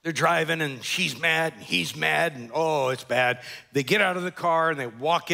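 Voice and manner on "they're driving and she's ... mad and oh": ordinary talk, nothing marked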